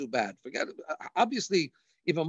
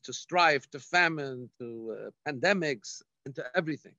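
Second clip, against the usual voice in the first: ordinary talk, nothing marked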